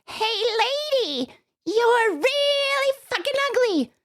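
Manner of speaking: high, drawn-out squawky parrot; high-pitched joke-parrot insult. Acted. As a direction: heckle like a rude parrot. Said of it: "the parrot says".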